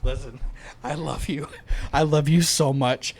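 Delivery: soft, low and tender; tender, pitying. Real, not acted. Gently letting a confused friend down, soft and tender. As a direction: break it to them gently, quietly. Gently lets Caleb down after argument.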